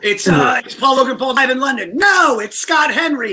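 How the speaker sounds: high, hammed-up contest urgency; mock frantic quiz. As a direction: blurt the answers in pretend panic. Acted frantic music quiz.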